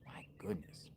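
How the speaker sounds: a breathy whisper of amazement; whispered disbelief. Real, not acted. Vlogger stunned by sheriff talk, whispering. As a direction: say it to yourself, barely voiced.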